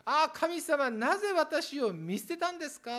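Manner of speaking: high, loud desperate plea to God; anguished cry. Pretend. Preacher acting the sinner crying out to God, loud and anguished. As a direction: cry out as one abandoned by God. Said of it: "って叫ばなければならなかった".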